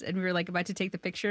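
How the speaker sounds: normal talking voice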